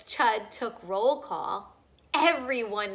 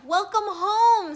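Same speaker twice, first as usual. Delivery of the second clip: higher, louder, slow greeting; louder, slower booming welcome; hearty welcome; 9 semitones higher, 9 dB louder than usual. Acted. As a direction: greet her warmly.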